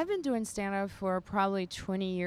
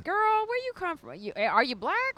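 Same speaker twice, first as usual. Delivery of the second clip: sassy audience member; 8 semitones higher, 6 dB louder than usual. Retold or imitated. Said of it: "they were like".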